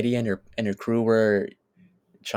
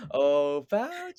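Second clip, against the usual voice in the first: playful character call; 4 semitones higher, much slower than usual. Acted. Fan calling out to a beloved film character, playful high call.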